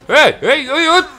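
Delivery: high loud slow squeals; panicked squeal. Real, not acted. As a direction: squeal in panic as the fight turns. Panicked in combat.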